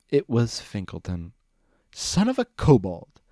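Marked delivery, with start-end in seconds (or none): 1.9-3.2 loud, slow, high oath; shouting. A narrator's reading. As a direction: bellow it out in shock. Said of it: "Discord shouted".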